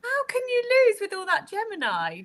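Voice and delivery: high-pitched incredulous question; amazed, voice way up high; playful disbelief. Real, not acted. Teasing disbelief at brother winning.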